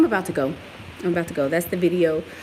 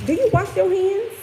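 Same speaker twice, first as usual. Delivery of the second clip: mocking question; 12 semitones higher, 4 dB louder than usual. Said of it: "she said".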